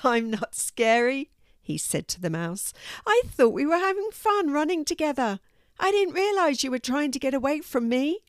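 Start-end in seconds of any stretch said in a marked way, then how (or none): 0.0-1.3 lightly amused, raised cat voice; mildly tickled cat. A narrator's reading. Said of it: "Seymour laughed". 3.1-8.2 high, earnest cat voice; high-pitched well-meaning cat; friendly cat character. A narrator's reading. Narrator voices the cat Seymour.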